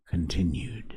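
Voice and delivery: hushed closing whisper. A narrator's reading. Narrator ends the chapter for listeners, whispered. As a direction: breathe the word out.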